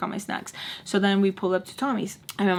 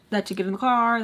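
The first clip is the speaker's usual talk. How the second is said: ordinary delivery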